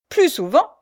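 high, loud interjection; indignant retort. A narrator's reading. Retort to a request to unchain her, indignant. Companion scornfully rejects the request.

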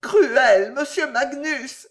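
loud, high and slow tearful cry; stammering reproach. A narrator's reading. Lady wounded by her suitor, tearful stammer. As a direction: sob out the reproach. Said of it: "balbutia la dame".

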